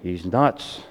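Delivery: mock whispered judgement; low aside. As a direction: drop it under your breath. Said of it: "they're going".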